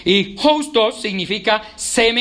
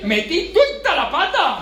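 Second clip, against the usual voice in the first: put-on light Boyacá country accent, higher and slow with a laugh; a playful Boyacá local; 9 semitones higher, slower than usual. Preacher joking about how Boyacá people talk, light Boyacá accent. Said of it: "ellos dicen así".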